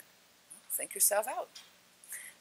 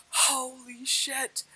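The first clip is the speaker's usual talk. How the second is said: slow, drawn-out hushed awe, higher pitch; awed exclamation; 6 semitones higher, much slower than usual. Retold or imitated. Storyteller reliving her proof moment, drawn-out awe. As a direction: stretch it out in amazement. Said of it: "And I'm like".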